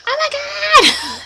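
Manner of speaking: very high, slow "Oh my God!" squeal; fangirl squeal. Retold or imitated. Fans reacting to the Oscar Wilde video, high squeal. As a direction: shriek it like a thrilled fan. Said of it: "now we're like".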